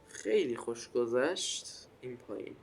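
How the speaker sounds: ordinary delivery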